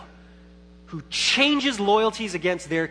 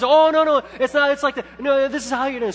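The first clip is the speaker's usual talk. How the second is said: much higher and louder, brightly insisting; cheery insistent instructor; 8 semitones higher, 6 dB louder, faster than usual. Retold or imitated. Instructor insisting to the dad, high eager voice. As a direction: play the peppy teacher correcting him.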